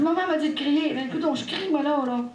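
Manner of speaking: voix nettement plus aiguë, implorante; cri de prière. Retold or imitated. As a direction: crier vers le ciel avec ferveur. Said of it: "J'ai dit". (la mère crie sa prière à Sainte-Anne, aiguë et pressante)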